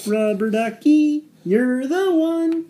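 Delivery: high, slow singsong puppet voice; Ernie voice. Retold or imitated. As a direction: sing it out like Ernie. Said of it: "That's what Ernie says".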